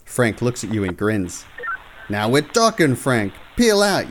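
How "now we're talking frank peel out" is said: rising to a loud, high "Peel out"; eager cop urging speed; excited. Acted. Rookie urging Frank to peel out, excited. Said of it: "you say excitedly".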